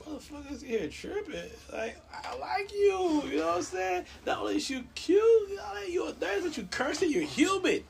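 very high falsetto excitement; delighted echo. Retold or imitated. Patient thrilled that his nurse curses, delighted falsetto. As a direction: gush in a squeaky high pitch, hammed-up. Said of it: "I'm like".

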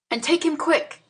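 brisk command; firmly. A narrator's reading. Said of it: "I said firmly".

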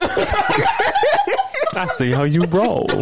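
high slow laughing taunt. Acted. Playful mock hurt.

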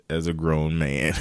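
ordinary delivery

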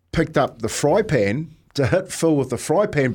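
ordinary delivery

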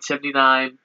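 ordinary delivery

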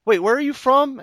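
high-pitched, curious mock question; puzzled voice of someone who just met you; surprised questioner. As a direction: imitate a puzzled stranger asking, pitched well up. Imagined stranger's surprised question.

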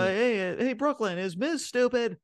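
high, mock crowd-pleasing call-out. Seth mockingly polls the Brooklyn crowd, high playful call. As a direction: lightly play a wrestler working the crowd.

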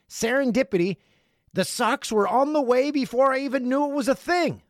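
high excited voice. Acted. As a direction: read it with glee. Excited letter reading.

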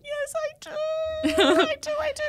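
very high, slow, small obedient voice; mock meek reply to a stern midwife; meek obedience. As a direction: squeak out meek agreement. Meekly obeying stern midwife.

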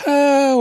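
high drawn-out robot "oh"; imitated robot cry. Fan recalling the robot scene, high imitated cry. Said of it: "he turned into a robot".

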